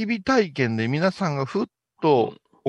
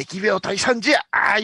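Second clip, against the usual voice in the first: mock exorcist cry; 7 semitones higher than usual. Acts a background chant over fire.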